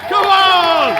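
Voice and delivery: high, loud cheer; yelling from the sidelines. Real, not acted. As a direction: cheer it at the final whistle. Final whistle celebration in the stands.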